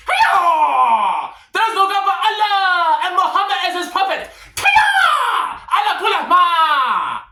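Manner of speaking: high, loud hammed-up karate shouts; over-the-top martial arts yelling; mock ninja battle cry. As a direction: scream it like a cartoon ninja warrior.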